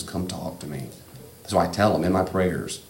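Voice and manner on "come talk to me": soft, gentle fatherly invitation; much softer, tender call to come close; caring father. Retold or imitated. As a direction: say it like a loving father calling his child over. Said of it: "but a caring father says".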